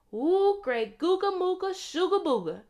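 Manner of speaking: high, loud sing-song incantation; magic spell. Acted. Sunday casting a spell on the torn shoes, high and loud. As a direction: chant the spell with a flourish.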